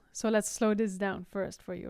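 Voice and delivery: normal talking voice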